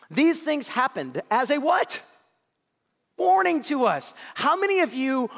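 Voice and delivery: rising preacher call; emphatic preaching call. Real, not acted. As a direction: call it out to the congregation. Preacher calls for response.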